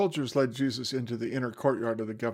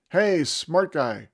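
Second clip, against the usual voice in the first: slow, higher, loud taunt; jeering yell; 5 semitones higher, 8 dB louder, slower than usual. Acted. Passers-by yelling at Jesus on the cross. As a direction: jeer at him from the road. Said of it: "Some of them yelled at him".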